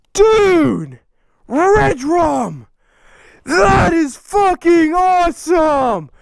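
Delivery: overwhelmed, shouting with joy; real hype. Real, not acted. Unboxing fan's flag of his logo, genuinely thrilled shouts.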